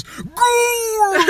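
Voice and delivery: high, drawn-out prospector holler; old-timey prospector. Acted. An old-timey prospector bursts into a romance, high mock holler. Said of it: "an old-timey prospector comes in and goes".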